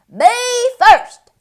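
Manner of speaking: high loud squeal; eager pig yelling; cried. A narrator's reading. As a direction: yell to be first. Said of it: "cried Pinkerton".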